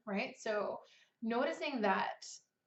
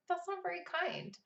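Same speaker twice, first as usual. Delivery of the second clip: higher and softer, kind; gentle; 8 semitones higher, 5 dB quieter than usual. Shown as a demonstration. Writer gently answering critical thoughts, soft and kind. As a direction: ease it out gently. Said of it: "I will just say very gently".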